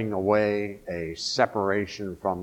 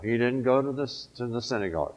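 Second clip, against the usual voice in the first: ordinary delivery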